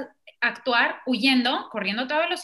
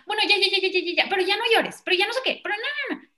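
a higher, rushed, dismissive shushing; impatient hushing; 7 semitones higher, faster than usual. Retold or imitated. An adult rushing a crying person to stop, higher and dismissive. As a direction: brush off someone's tears, hurrying them. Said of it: "alguien llega".

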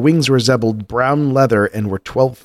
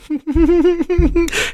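high laugh. Real, not acted. Laughs at own joke.

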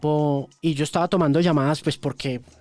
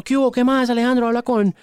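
higher, louder, bright hello of a caller; cheery soldier on the phone; 9 semitones higher, 3 dB louder than usual. Retold or imitated. Soldier calling the radio host, higher and louder greeting.

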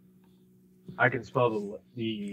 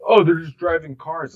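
dismissive mimicry; 4 semitones higher, 7 dB louder than usual. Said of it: "these people are like".

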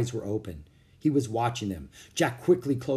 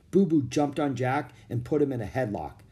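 plain reading voice

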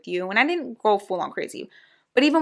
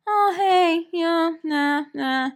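aimless caller; 8 semitones higher, much slower than usual. Retold or imitated. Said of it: "If you're just gonna call me".

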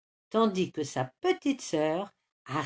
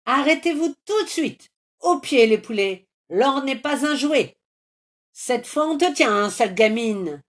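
high, loud angry yelling; angry chase shout; 6 semitones higher, 7 dB louder than usual. Acted. Trolls chasing the hen, furious yelling. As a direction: bellow after the runaway.

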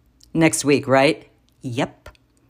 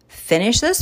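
"Finish this?" echoed higher with a sharp rising question; incredulous echo; 7 semitones higher than usual. Real, not acted. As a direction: throw "this" back in disbelief. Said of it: "Finish what?".

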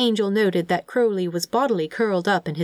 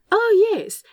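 high, louder exclamation; light up at the idea; delighted; 5 dB louder than usual. Acted. Angel seizing on the demon's idea, delighted.

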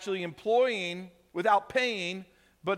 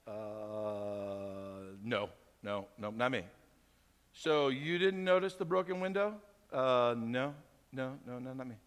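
put-on much lower, very soft, scared kid mumble; timid child denial; 7 semitones lower, 6 dB quieter, slower than usual. Child caught by parents, timid and mumbling. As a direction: shrink away and deny meekly. Child denying broken window.

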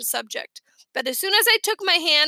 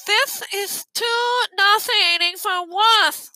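slow, high, disgusted congested voice; stuffed-nose voice; 7 semitones higher, 4 dB louder, much slower than usual. A narrator's reading. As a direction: complain laboriously through a pinched nose. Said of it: "they had the worst colds".

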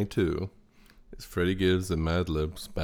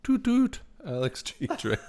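high, playful choo-choo call; train whistle call. Pretend. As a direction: sing it like a toy train whistle.

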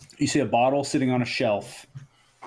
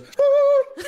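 a sudden, high squawk from the wall fish; startled fish yelp; 5 dB louder than usual. Acted. Wall fish yelping at the shoppers, sudden high squawk. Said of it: "the fish on the wall flops forward and goes".

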